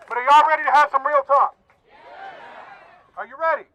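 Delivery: loud, rousing call to the crowd; rally leader firing up the audience; crowd hype call. Real, not acted. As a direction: pump the crowd up.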